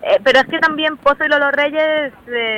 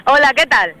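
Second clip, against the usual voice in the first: louder, festive hello close to the mic; cheerful greeting; 2 semitones higher, 5 dB louder than usual. Real, not acted. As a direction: greet like it's a birthday party. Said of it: "salúdame otra vez, como si fuera nuestro cumpleaños". (saludo alegre de cumpleaños)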